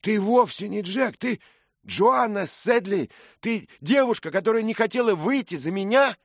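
loud, high-pitched cry of discovery; astonished shout. A narrator's reading. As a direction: blurt out the revelation at full voice. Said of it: "крикнул он". (громкий изумлённый крик)

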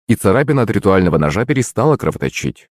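plain reading voice

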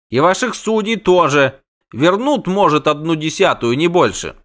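high, grumbling protest; indignant halfling. A narrator's reading. Halfling refuses to trust local authorities, indignant. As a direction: argue it hotly, pitched high.

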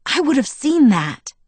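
indignant. A narrator's reading. Alice defends herself.

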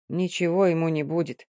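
plain reading voice

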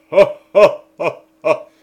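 loud manic king's laugh; mad laughter. Acted. The king in his fit bursts into horrible loud laughter. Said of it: "il eut un éclat de rire qui résonna affreusement".

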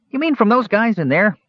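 high-pitched, plucky kender; plucky confidence, starting very high; stout. A narrator's reading. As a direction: say it stoutly in a small hero's high voice. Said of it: "said Earwig stoutly".

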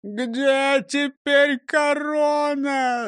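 slow, high-pitched wail; hammed-up sobbing lament; bawling. A narrator's reading. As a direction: cry it out in long, tearful howls. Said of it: "заревели разом в три ручья".

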